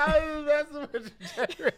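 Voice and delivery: laughing through the words. Real, not acted. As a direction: giggle while confessing. Owns up to teasing.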